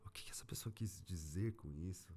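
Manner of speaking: hushed, breathy whisper throughout. Real, not acted. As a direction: whisper as if reviewing secretly. Whispering while dissecting client audio.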